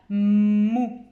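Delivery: "mou" long and stressed, humming on the m; stretched first sound. Shown as a demonstration. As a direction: lean on the m before opening "mou". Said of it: "quel est le son que l'on entend en premier". (« mou » appuyé, m bien prolongé)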